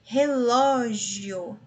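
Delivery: Relógio stretched slowly; slowed Relógio for the class; slow syllable demo. Shown as a demonstration. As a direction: sound out Relógio deliberately. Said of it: "mais um ditongo aqui".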